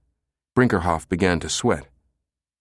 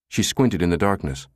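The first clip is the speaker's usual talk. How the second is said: ordinary delivery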